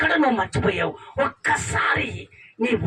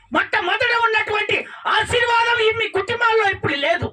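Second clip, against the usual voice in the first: fiery preaching; 9 semitones higher than usual. Real, not acted. Preacher rebuking believers who forgot God, fiery sermon. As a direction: rebuke the flock with fervor.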